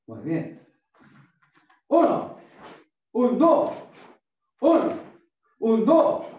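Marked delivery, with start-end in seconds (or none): 1.9-6.3 high shouted count; barking gym coach. Real, not acted. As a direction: bark out the count loud and high. Coach counting drill reps aloud.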